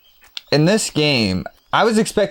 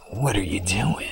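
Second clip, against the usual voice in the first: Snake voice; 9 dB quieter than usual. Shown as a demonstration. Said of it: "I can do a solid Snake voice".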